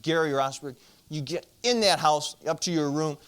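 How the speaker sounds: loud, sharp order using his full name; mother ordering her son upstairs, loud and firm; angry mother's order. Retold or imitated.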